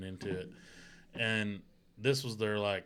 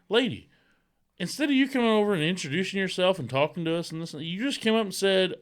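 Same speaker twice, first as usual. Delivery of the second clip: raised, higher mock rant; retold rant; 6 semitones higher, 4 dB louder than usual. Husband scolds the rude neighbor, heated mock rant.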